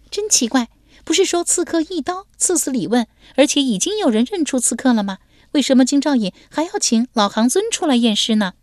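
put-on high, lively girlish voice; young woman's voice. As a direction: play the bold young lady teasing him. Young woman puzzles aloud.

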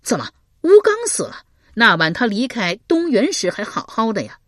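高而响的震惊发问; 猛地拔高声音吃惊; 大吃一惊. Acted. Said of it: "刘伶大吃一惊". (刘伶听闻吴刚死讯大惊)

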